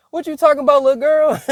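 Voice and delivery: high, loud disbelieving squeal; incredulous. Retold or imitated. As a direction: shriek it in mock disbelief. Incredulous reply to cousin.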